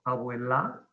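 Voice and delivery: "abuela" as abue-LÁ, the last syllable held and rising; wrong stress on LA. Shown as a demonstration. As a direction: lean hard on final la in "abuela". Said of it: "No es abuela". ("abuela" exagerando el acento final, abuelá)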